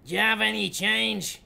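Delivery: put-on loud, high Dublin accent. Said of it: "Put on a Dublin accent for the punchline".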